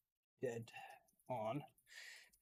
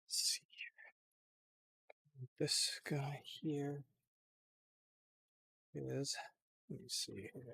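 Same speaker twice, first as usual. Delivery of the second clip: slow whispered aside; whispering; 6 dB quieter than usual. Real, not acted. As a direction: whisper to yourself.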